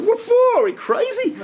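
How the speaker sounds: high, hammed-up disbelief; mock incredulous. Rabbi voicing a colleague rebuffing a would-be convert, incredulous. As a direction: scoff like a shocked rabbi.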